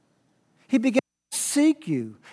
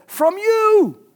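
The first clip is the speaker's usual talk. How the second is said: FROM YOU punched out loud and high; emphatic; 13 semitones higher, 8 dB louder than usual. Shown as a demonstration. Preacher shows church how emphatically Paul says it, emphatic. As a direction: hit "From you" hard, pitched way up. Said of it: "it's emphatic".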